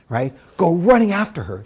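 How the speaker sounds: ordinary talk, nothing marked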